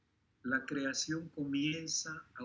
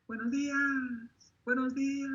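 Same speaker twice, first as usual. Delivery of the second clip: imitating little girl; 12 semitones higher, 4 dB louder than usual. Preacher mimics the neighbourhood girl's morning greeting, high childlike call. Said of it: "se escucha la voz de esta niña".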